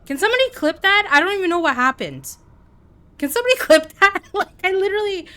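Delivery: high, loud laughing plea; excited disbelief. Real, not acted. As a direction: shout to chat half laughing in disbelief. Laughing at absurd kill.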